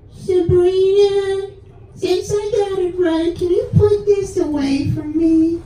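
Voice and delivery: put-on high, slow sing-song little girl; sweet little girl voice. Goddaughter sweetly asking her older sister. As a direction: coo it like an innocent seven-year-old.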